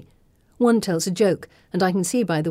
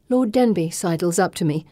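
ordinary delivery

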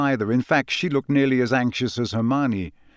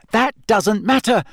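high, firm and louder; firm Hermione; 10 semitones higher, 5 dB louder than usual. A narrator's reading. Hermione brushing off Harry's worry, firm. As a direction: insist firmly in a high voice. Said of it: "said Hermione firmly".

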